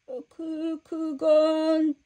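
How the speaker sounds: soft hesitant stammer, very slow; stammering in fear. Acted. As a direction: caught out, searching for words. Servant caught, stammering.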